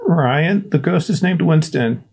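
put-on high, scared kid whimper; frightened boy squeaking out the words; scared boy whimpering to his friend. A narrator's reading. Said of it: "Ernest whimpered".

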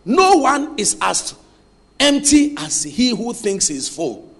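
slow and deliberate, word by word; measured dictation pace. Shown as a demonstration. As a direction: dictate it slowly for them to write. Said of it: "Listen, write this".

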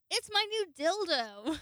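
high, loud, slow triumphant reveal; proud reveal. Retold or imitated. Showing the new dildo to Alexis, loud and triumphant. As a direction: present it like a trophy.

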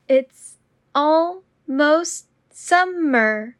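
slow and deliberate. Shown as a demonstration. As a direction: draw out every word. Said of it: "repetition drill, slowed take".

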